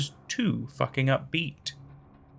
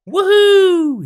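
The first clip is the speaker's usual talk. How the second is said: high, loud whoop of joy; gleeful shout; 11 dB louder than usual. Acted. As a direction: whoop it out from the bridge. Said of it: "he shouted".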